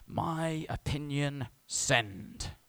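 slow, drawn-out typing-out voice; mock self-important posting. Preacher mimicking someone posting on Facebook, mock self-importance. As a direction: stretch each word as if composing a post.

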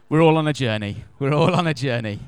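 laughing through the words. Real, not acted. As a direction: chuckle it out, amused at the joke.

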